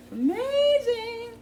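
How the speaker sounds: high, drawn-out "amazing"; excited. Real, not acted. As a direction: sing out in delight. Raving about the smell.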